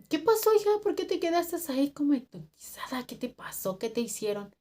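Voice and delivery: high-pitched motherly questioning; imitated concerned older coworker. Cleaning lady asking the stunned worker, high concerned voice.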